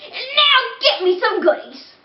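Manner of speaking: high, pleading little tooth voice; whiny talking tooth. Acted. Tooth begging the boy for sweets, high and whiny. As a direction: beg like a greedy tooth.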